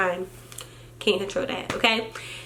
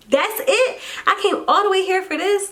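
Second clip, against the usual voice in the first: much higher incredulous "that's it"; outraged disbelief; 9 semitones higher than usual. Retold or imitated. Scene: mom recalls her reaction to the short visit, high disbelief. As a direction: squeal it out in disbelief.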